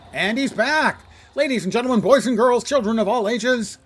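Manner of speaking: booming circus announcer; big showman introduction; ringmaster. Pretend. Announcing the boss arrival.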